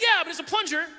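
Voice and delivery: high, loud incredulous comeback; exasperated retort. Retold or imitated. Husband to wife in their first fight, loud incredulous retort. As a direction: hit back at the absurdity.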